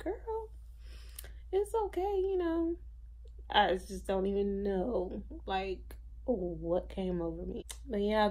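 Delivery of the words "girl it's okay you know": much higher, softened mom voice; imitated reassuring mom. Mom to her apologizing daughter, imitated high reassurance. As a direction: mimic mom brushing off an apology, high-pitched.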